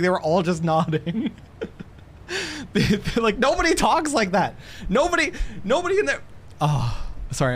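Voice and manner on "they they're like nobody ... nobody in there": high, worked-up protest; real heated rant. Real, not acted. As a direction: rant in exasperated disbelief. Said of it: "I'm getting heated".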